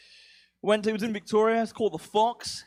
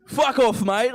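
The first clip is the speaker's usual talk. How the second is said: loud, higher mock Aussie yob; 6 semitones higher, 5 dB louder, slower than usual. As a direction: holler it like a hostile yob.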